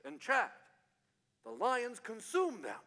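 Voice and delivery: put-on understated South African accent. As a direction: deliver it deadpan in a hint of South African. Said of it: "hearing it from a South African accent".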